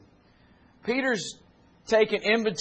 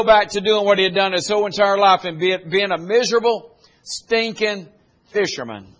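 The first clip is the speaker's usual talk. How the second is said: rising, louder sermon cadence; full-force preaching; 6 semitones higher, 6 dB louder than usual. Acted. Pastor exhorting the church, building and loud. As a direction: drive the message home to the congregation.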